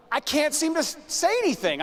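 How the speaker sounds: a frozen speaker realizing he can't speak; panicked inner voice. Retold or imitated. Frozen in front of audience.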